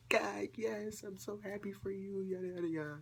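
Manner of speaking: high, gushing mock squeal of delight; imitating excited friend. Friend hearing the news, imitated as high-pitched excitement.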